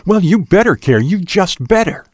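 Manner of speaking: high-pitched, cutting warning; cutting, calculating. A narrator's reading. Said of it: "Now her voice was cutting, calculating".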